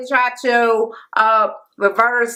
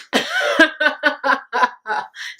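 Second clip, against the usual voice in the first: a short real laugh, slightly higher; amused chuckle; 6 semitones higher than usual. Real, not acted. Laughs at own remark.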